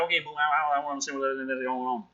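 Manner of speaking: rushed, slurred Southern accent; flustered Southern accent. Shown as a demonstration. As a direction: mumble it fast in a Southern accent. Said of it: "I gotta speak really fast, so it comes out a little bit".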